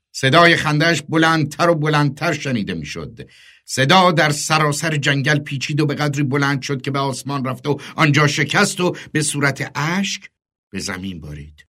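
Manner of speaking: ordinary delivery, nothing marked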